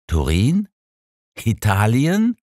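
can't believe the trip; mildly astonished, rising pitch; stunned disbelief. A narrator's reading. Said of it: "fragte ich fassungslos".

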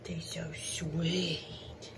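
slow, soft whisper; tender whisper. Real, not acted. Cooing over the boys, slow tender whisper. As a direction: linger on it, barely breathing.

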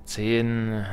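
normal talking voice

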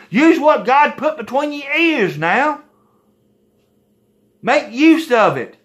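high, slow, pleading exhortation; slow, high, fervent urging; worked-up plea. Real, not acted. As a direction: drive it home slowly and high.

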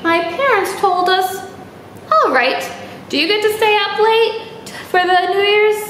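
lightly lilting storybook voice; reading to children. Acted. Teacher reading a New Year's story to kids. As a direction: read it brightly to little listeners.

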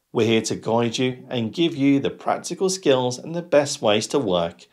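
usual speaking voice

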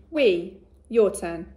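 we said slowly and loudly; slow modelled word. Shown as a demonstration. Teacher models we for the class, slow and loud. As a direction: hold we out slow and loud for copying. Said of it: "My turn".

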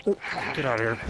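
normal talking voice

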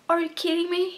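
mock stunned in English; incredulous. Fan reacting to news of another cast exit, incredulous. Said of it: "Och jag bara".

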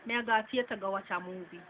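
Jamaican accent, casual example sentence; Jamaican Patois. Shown as a demonstration. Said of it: "we would say".

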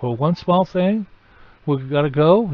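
a hint of whining kids; childlike protest. Adam and Eve pleading with God to stay, mildly whiny. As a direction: plead a little, like children asking. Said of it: "protesting, too, like kids do".

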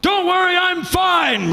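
high, strained holler back; comically strained high shout; shouted false reassurance. Acted. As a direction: call across the water, insisting all is well. Struggling swimmer calls back to lifeguard.

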